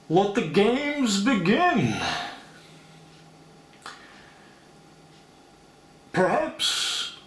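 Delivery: slow, masked villain voice; a hint of Bane speaking through a mask. Bane as villain, slow and masked-sounding. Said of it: "let's do Bane as well".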